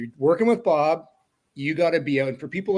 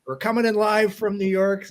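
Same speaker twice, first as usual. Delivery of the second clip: lightly done announcer impression, higher; a hint of the old play-by-play voice, pitched up; Bob Cole; 7 semitones higher, 3 dB louder than usual. Announcer welcomes viewers from another game, lightly imitated voice. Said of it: "do it in the Bob Cole voice".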